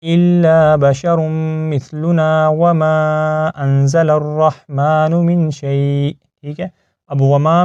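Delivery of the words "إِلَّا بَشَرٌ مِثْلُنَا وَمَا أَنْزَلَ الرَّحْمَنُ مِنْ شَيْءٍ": slow recitation running on past مِثْلُنَا without stopping; joined-up recitation. Shown as a demonstration. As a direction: recite as one continuous chanted phrase. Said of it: "پیچھے سے ملا کے ہم اس کو پڑھیں گے". (وقف کیے بغیر ملا کر تلاوت)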